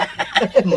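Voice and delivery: short laugh. Acted. Laughs at own thumbs up reply.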